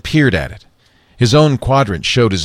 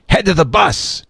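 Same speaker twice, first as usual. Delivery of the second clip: raised, higher urgent warning; urgent call; 9 semitones higher than usual. A narrator's reading. Lee Daniel directs investors.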